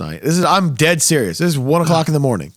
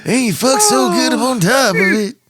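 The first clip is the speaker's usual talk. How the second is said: hammed-up folk-singer spoof, pitched way up; 11 semitones higher than usual. Host joining in the song spoof, high mock impression. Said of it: "what does [name] doing Crazy Bitch sound like".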